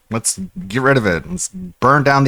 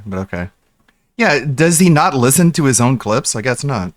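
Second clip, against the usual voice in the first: ordinary delivery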